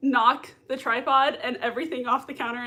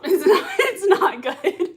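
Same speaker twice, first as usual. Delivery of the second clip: high, loud words through laughter; giggly, breaking into laughs; laughing; 7 semitones higher, 6 dB louder, slower than usual. Real, not acted. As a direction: laugh helplessly while complaining. Laughs about sweating nonstop.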